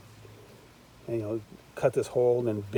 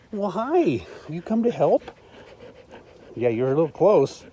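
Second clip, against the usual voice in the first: talking to dog; 4 semitones higher, 6 dB louder than usual. Real, not acted. Greeting dog Daisy.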